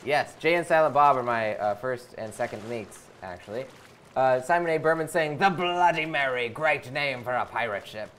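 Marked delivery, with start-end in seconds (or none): 5.4-8.1 lightly voiced pirate. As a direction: read it like a pirate captain. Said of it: "I will read it out in a pirate voice".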